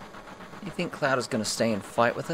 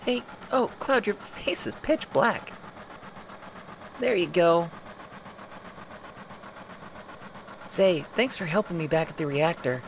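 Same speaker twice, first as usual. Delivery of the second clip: put-on high, bright girlish voice; high playful Jessie-type voice; cheerful young woman; 6 semitones higher, 4 dB quieter than usual. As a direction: play her light and friendly.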